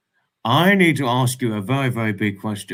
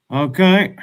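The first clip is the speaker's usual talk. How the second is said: louder, higher okay; loud exclaim; 6 semitones higher, 6 dB louder than usual. Real, not acted. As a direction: call out okay. Technical trouble.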